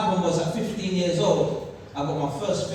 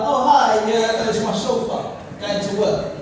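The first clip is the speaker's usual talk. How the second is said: showing off; 4 semitones higher, 5 dB louder, slower than usual. Retold or imitated. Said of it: "So I could be like".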